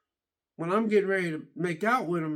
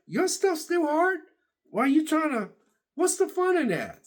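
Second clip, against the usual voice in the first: incredulous; 10 semitones higher than usual. Real, not acted. Asking viewers, high-pitched incredulous. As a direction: ask it in total disbelief.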